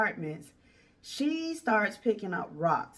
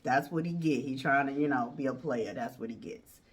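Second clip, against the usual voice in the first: laughing homeboys; 6 semitones lower than usual. Retold or imitated. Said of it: "His homeboys are even laughing like".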